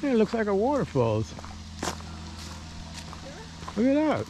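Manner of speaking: high, delighted exclamation; thrilled at the view; excited. Real, not acted. Seeing the waterfall.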